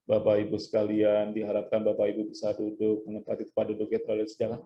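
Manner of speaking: low, unvarying droning announcement; monotone demo. Shown as a demonstration. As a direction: drone through it on one note as a bad example. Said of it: "jangan monoton". (contoh bicara monoton, datar dan rendah)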